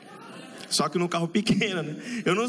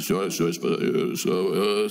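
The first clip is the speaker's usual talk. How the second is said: much lower, croaky, like holding back a burp; burpy airline pilot voice; 10 semitones lower, much slower than usual. Retold or imitated. Pilot addressing passengers, about to burp. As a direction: sound as if you just downed a fizzy soda. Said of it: "aquela voz daquele cara que acabou de beber um refrigerante com gás".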